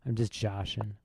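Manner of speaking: ordinary talk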